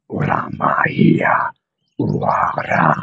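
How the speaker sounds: deep, slow breathy dragon growl; dragon roar. Pretend. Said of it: "your dragon roar in public".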